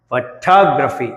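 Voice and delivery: Photography with a weak opening and strong second syllable TOG; stress on second syllable. Shown as a demonstration. Teacher modelling for students, second syllable of photography stressed. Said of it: "talk దగ్గర stress వచ్చింది".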